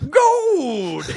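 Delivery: hammed-up gold prospector exclamation; old prospector voice. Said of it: "Go with Old Prospector".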